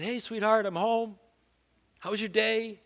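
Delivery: high, sugary faux-innocent greeting; overly sweet, fishing for a confession; sweet probing tone. Husband greeting wife in a high, fake-sweet voice. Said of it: "a completely different tact".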